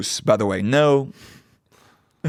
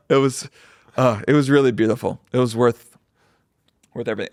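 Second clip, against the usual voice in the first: normal talking voice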